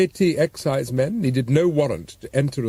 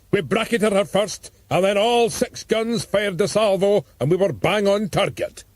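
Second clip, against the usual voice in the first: artillery officer; 7 semitones higher than usual. Acted. Officer reports the shelling.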